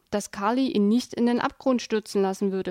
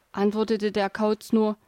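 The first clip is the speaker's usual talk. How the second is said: ordinary delivery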